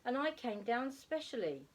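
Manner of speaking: put-on high, mildly cross child's complaint; a touch of sulky indignation; crossly. A narrator's reading. Plop scolds the lady for not being Father Christmas, mildly cross. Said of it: "he said crossly".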